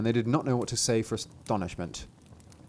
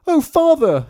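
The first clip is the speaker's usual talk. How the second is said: tiny figure's high, loud cry; 11 dB louder than usual. A narrator's reading. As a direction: call out in a very high little voice. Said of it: "cried Thumbling".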